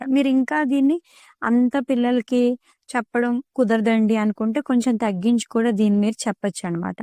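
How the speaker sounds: ordinary talk, nothing marked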